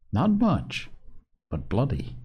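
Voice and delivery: plain reading voice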